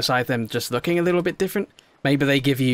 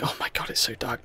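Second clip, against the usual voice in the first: scared whisper; 3 semitones lower, 7 dB quieter than usual. Real, not acted. Head underwater in the dark.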